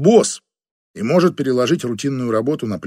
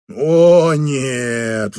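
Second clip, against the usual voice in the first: wailing. Acted. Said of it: "взвыла она".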